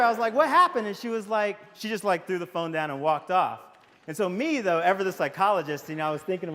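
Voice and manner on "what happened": raised, loud, alarmed query; startled question. Retold or imitated. Husband asking his wife after the call, startled. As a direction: ask it high and loud, taken aback.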